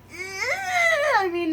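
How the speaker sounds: a high, drawn-out meh of disappointment; unimpressed eh. Real, not acted. To viewers about an overpriced palette, unimpressed meh. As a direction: let it hang like a shrug. Judging disappointing product quality.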